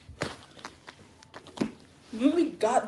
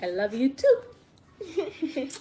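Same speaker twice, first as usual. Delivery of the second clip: tender reply; 4 semitones higher, much slower than usual. Real, not acted. Answering her small child.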